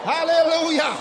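high cries of worship; shouted praise. Real, not acted. Preacher praises with the congregation, shouting. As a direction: call out praise to the crowd.

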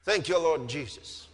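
ordinary talk, nothing marked

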